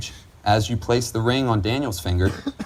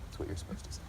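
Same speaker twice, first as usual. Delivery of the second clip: 悄悄耳语; whispered aside; 17 dB quieter, much faster than usual. Real, not acted. Officiant aside during vows.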